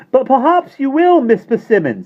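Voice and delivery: put-on high, loud cheeky boy; Michael's voice. A narrator's reading. Michael teasing the landlady, high and cheeky. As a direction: tease her with a grin.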